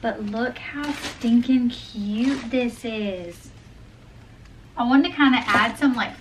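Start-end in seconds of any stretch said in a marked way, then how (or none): 0.0-3.5 slow, drawn-out cooing over something cute; gushing delight. Real, not acted. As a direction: gush slowly, raised pitch, savoring each word. Showing off her new pullover design.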